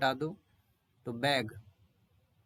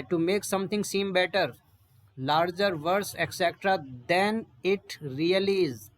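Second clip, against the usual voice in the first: very slow and a bit louder; slow dictation; 3 semitones higher, 5 dB louder, much slower than usual. Shown as a demonstration. Teacher dictating the meaning, slow and deliberate. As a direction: read the definition out slowly. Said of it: "reads the definition slowly".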